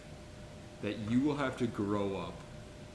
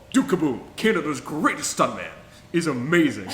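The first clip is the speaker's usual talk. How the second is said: louder, eager naming of Duke Kaboom; excited praise; 3 semitones higher, 8 dB louder than usual. Real, not acted. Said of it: "First of all".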